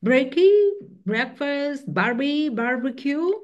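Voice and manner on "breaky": "breaky" said high and loud, bright Aussie slang. Shown as a demonstration. Teacher showing Aussie slang, "breaky" high and loud. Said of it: "they shorten the words like".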